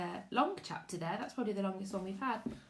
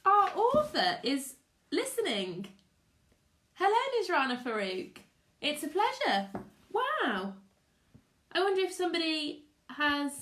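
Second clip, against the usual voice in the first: thrilled; 6 semitones higher, 4 dB louder than usual. Real, not acted. Thrilled the author is listening.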